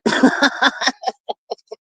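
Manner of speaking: long run of chuckles trailing away; amused laughter. Real, not acted. Host laughing at Miss Sonya's apology, amused laughter. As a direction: laugh it out in a string of ha-ha's.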